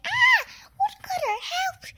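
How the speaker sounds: high shrieked cry for help; screaming for help. Acted. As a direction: scream for the woodcutter.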